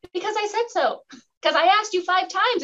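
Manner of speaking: high-pitched mock mom; mock strict mom. Host imitating a mom who answers why, mock stern. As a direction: ham up the stern mom line. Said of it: "the whole mom".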